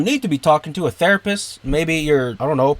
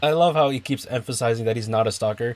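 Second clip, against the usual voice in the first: usual speaking voice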